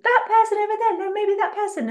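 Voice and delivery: high, loud, hammed-up dithering; dramatized fickle crowd. Mocking voters chasing the next leader, in a high, loud hammed-up voice. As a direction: squeak it like a flustered follower switching sides. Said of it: "I'm making it a bit bigger and dramatizing what everybody".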